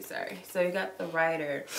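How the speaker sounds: ordinary talk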